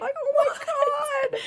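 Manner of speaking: high, drawn-out squealing near-sob with laughter; overwhelmed mock cry. Dancer reliving her Vegas ticket, mock tearful squeal. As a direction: wail it joyfully, hammed up.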